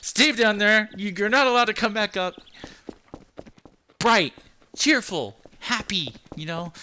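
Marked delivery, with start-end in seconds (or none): 0.0-2.3 mock order. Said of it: "It's like".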